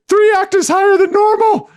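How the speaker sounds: comic falsetto squeal; exaggerated, very high shocked voice; voice shooting way up. Shown as a demonstration. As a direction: jump sky-high and squeal it out. Demonstrates own high reaction voice.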